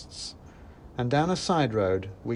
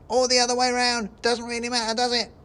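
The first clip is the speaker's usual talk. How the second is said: very high, louder, careless squeak; offhand squeaky mouse; 12 semitones higher, 5 dB louder than usual. Acted. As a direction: shrug it off like a tiny heckler. Third mouse adds casually.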